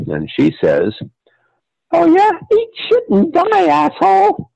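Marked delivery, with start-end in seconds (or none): 1.9-4.5 put-on very high, squeaky child cursing; a sweet little girl swearing like a sailor; bratty little girl voice. Retold curse from sweet child actress.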